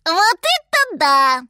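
high, loud, drawn-out squeal; exclaiming monkey. A narrator's reading. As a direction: marvel loudly in a monkey voice. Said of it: "воскликнула обезьянка".

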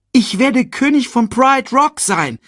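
high, loud excited cub; proud announcement. A narrator's reading. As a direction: announce it proudly to your uncle. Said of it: "verkündete Simba".

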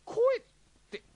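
high, loud startled stammer; jolted, nervous question; alarmed. Acted. Alarmed at being asked.

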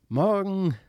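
a farewell sent from the distance; calling from afar. A narrator's reading. The strange child calling back to the children from far off. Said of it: "rief es aus weiter Ferne".

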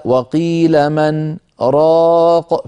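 very slow chanted recitation; Quran recitation. Shown as a demonstration. Teacher cites the verse, in very slow chanted recitation. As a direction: chant the verse slowly and evenly. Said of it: "من قوله تعالى".

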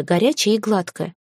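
ordinary delivery, nothing marked

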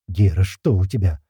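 ordinary delivery

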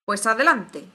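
loud, commanding shout; bellowing. A narrator's reading. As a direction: bellow the order to proceed. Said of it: "vociferó la reina".

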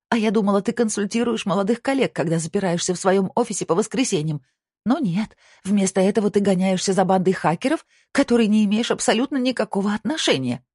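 high, forceful female character voice; Kristina, indignant wife. A narrator's reading. Wife berates husband about his secret investigation, indignant. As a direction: pile on the reproaches, pitched high.

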